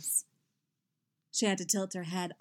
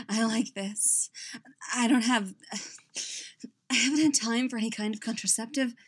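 breathless, broken by pauses; panting; 4 semitones higher, 4 dB louder than usual. A narrator's reading. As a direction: speak between pants, pushing words out. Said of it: "she panted".

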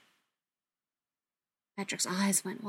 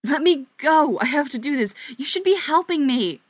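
loud, higher, struggling shout; desperate yell; 6 semitones higher, 9 dB louder than usual. Acted. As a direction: yell to be let go. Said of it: "Patrick could do was yell".

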